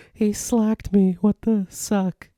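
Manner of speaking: high, slow, mocking squeak. Laughing at himself for saying slacked, silly high voice. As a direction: parody your own slip in a silly high voice. Mocking his own slip.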